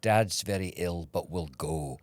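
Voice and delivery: slow, resolute Scottish mother's voice; Scottish mom. Retold or imitated. Mom insists despite sick dad, Scottish accent. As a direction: put on a stubborn Scottish accent. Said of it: "your mom's Scottish accent".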